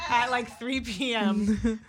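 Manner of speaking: slow, loud, laughing words; laughing punchline. Real, not acted. Telling friends about her brunch mimosa, laughing.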